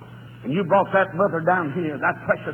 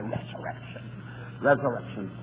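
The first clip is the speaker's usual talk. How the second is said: whispered awe; 7 semitones lower, 7 dB quieter than usual. Acted. Hushed awe after a build.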